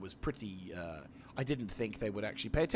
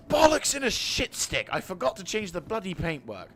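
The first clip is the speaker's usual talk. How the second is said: loud, high cursing yell; exasperated outburst; 7 semitones higher, 7 dB louder than usual. Real, not acted. Gamer realising he forgot the paintwork, exasperated outburst. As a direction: explode in frustration at your own slip. Curses own forgetfulness.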